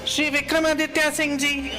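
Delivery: usual speaking voice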